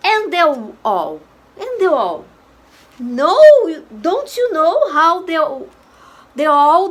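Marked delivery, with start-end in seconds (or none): none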